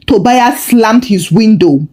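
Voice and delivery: ordinary delivery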